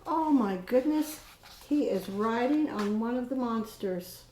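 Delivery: ordinary delivery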